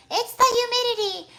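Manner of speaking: high-pitched mock exclamation; Monica from Friends. Streamer quoting a sitcom about frizzy hair, high comic impression. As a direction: squeal it like a sitcom character. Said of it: "Monica Bing, Monica, when they".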